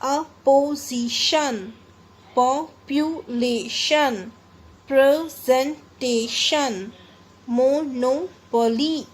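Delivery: each word from opposition to situation in four separate beats; teacher's dictation, situation as si-chu-e-shan; syllable by syllable. Shown as a demonstration. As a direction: break words like population into four clear syllables. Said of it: "हमने four अलग-अलग तरीके से".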